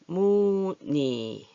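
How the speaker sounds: 무늬 very slowly drawn out as "moo-nee"; 늬 read as 니. Shown as a demonstration. Teacher shows learners how it is read, stretched 무늬. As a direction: stretch each syllable of 무늬, ending in "nee". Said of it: "니라고 읽어요".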